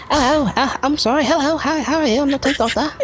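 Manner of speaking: high-pitched English accent impression; Tenth Doctor. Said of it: "his English accent is kinda high".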